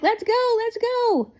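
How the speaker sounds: high, eager and pressing to leave; urgent excited. Retold or imitated. Freed wife urging family to leave prison, high and eager. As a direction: hurry everyone out, pitched well up. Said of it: "and then she's like".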